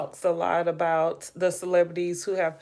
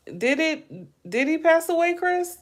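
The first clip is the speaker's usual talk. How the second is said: shocked; 10 semitones higher than usual. Real, not acted. Shocked question to Chris.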